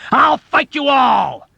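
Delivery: furious high scream, slow. Acted. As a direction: scream a challenge at the room. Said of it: "Dobbs screamed back at them".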